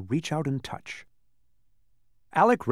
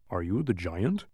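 low, hesitant question; doubtful Tock; 3 semitones lower than usual. A narrator's reading. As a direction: ask it low, unsure of the answer. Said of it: "asked Tock doubtfully".

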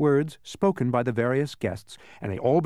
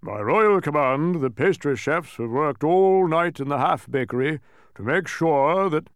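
pompous king; 5 dB louder than usual. A narrator's reading. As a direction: proclaim it grandly, stretching the key words. King's banquet announcement.

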